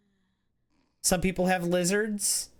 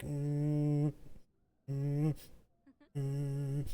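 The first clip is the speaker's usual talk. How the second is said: snake hum; 8 dB quieter, much slower than usual. Retold or imitated. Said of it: "they'll be like".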